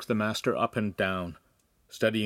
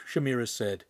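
usual speaking voice

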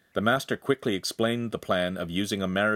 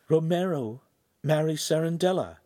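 repeated in disbelief; 8 semitones higher than usual. A narrator's reading. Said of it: "Giulietta repeated".

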